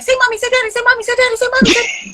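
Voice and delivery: high, rapid-fire pleading chant; frantic singsong mom voice; eager coaxing. Retold or imitated. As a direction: beg a toddler to repeat you, voice way up. Mother eagerly coaxing child to speak.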